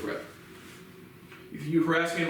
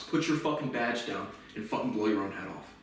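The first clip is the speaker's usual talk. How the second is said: ordinary talk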